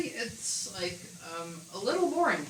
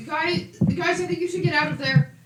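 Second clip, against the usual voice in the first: high, loud warning in character; worried companion calling out; 9 semitones higher, 6 dB louder than usual. Acted. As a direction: urgently warn the group from a distance. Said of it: "Sophia's like".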